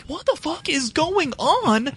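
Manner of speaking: high, slow disbelieving cry; incredulous high what-the-fuck; baffled outburst. Retold or imitated. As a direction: throw up your hands at the screen. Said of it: "And I'm like".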